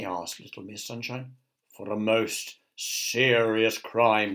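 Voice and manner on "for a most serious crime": slow, grave soldier voice. A narrator's reading. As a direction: pronounce the charge slowly and solemnly. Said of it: "replied the soldier".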